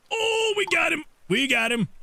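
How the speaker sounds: high thrilled cheer; excited. Real, not acted. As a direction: celebrate the catch. Catching the creature.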